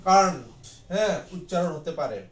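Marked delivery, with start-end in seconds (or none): 0.1-0.6 Kant said firmly and louder; teacher stressing the joined form Kant; emphatic model. Shown as a demonstration. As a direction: land hard on Kant. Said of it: "একসাথে".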